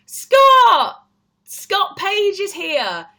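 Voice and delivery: high, loud excited call, slow. Real, not acted. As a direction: call out his name with delight. Excitedly calling to friend joining.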